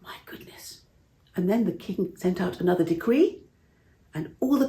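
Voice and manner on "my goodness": awed whisper. Real, not acted. Narrator's awed aside.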